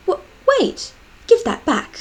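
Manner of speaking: a high, startled cry that leaps up, then demands; aghast. Acted. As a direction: caught off guard, grabbing the book back. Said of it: "she looked aghast".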